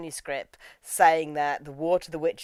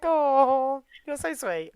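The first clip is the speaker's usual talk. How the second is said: adoring coo; 6 semitones higher, much slower than usual. Acted. Host moved by Joseph's clumsy bow, adoring coo. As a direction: coo it, nearly tearful with fondness. Cooing over sweet Joseph.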